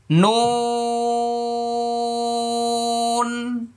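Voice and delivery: নুন held very long and high, lips round then open; slow tajweed demo of নুন; letter name drawn out. Shown as a demonstration. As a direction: stretch নুন on one note. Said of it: "দেখেন".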